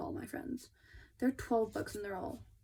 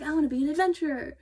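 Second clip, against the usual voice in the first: put-on eager child; 6 semitones higher, 7 dB louder, faster than usual. Young Gina voiced eagerly.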